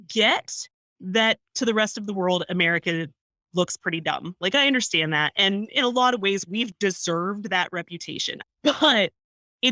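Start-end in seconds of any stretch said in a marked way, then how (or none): none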